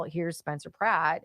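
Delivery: normal talking voice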